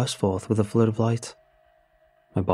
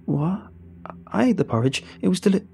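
higher, startled protest cut off; 8 semitones higher than usual. Acted. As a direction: blurt out the denial in surprise.

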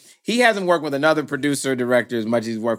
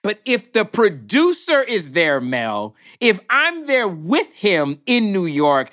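normal talking voice

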